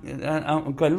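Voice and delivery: ordinary talk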